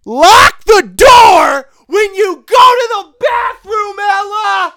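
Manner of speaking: yelled high and loud, slowed down; full-throated comic rant; shouted rule. Real, not acted. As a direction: bellow the rule across the room. Ranting rule number one.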